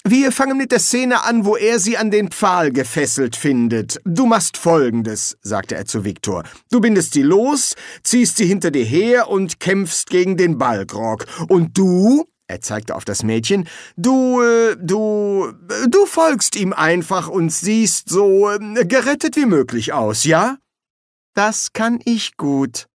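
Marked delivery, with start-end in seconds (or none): 0.1-5.4 higher-pitched Silberfisch giving orders; lightly voiced bossy director. 6.7-12.2 bossy director, high and loud; Silberfisch. Acted. 14.0-20.6 high, halting, drawn-out hesitations; groping for instructions. Acted. Director improvising instructions for the girl. 21.3-22.9 slow, high-voiced resignation; resigned. A narrator's reading. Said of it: "sagte sie resigniert".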